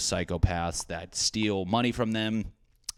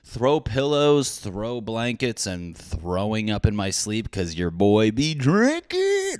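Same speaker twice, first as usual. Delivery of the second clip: hammed-up boast; 2 semitones higher, 5 dB louder, slower than usual. Comedian bragging about decor knowledge, hammed-up boast. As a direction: stretch each item like a big brag. Mock boasting list ends in yell.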